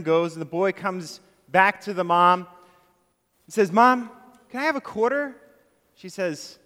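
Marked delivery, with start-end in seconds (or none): 3.7-5.4 put-on higher, lightly childlike plea; little boy asking. Boy asks his mom for a quarter again, childlike. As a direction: ask sweetly like a small boy.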